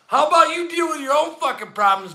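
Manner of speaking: ordinary talk, nothing marked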